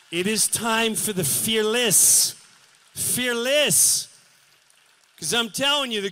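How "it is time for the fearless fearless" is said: rallying cry. Real, not acted. Rallying call to audience.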